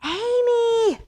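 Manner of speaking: high loud yell up the stairs; brother's yell. Acted. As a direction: call her name at full pitch. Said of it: "yelled as loudly as he could".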